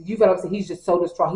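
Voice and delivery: ordinary talk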